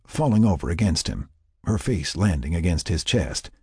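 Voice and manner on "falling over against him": usual speaking voice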